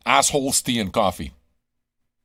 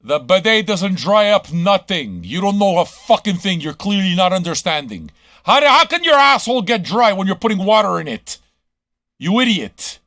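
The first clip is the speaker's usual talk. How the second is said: angry rant; 6 semitones higher, 6 dB louder than usual. Real, not acted. Ranting at listeners about bidets.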